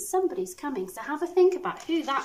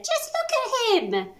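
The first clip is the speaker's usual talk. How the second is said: incredulous; 12 semitones higher than usual. Acted. Bees defending Norman.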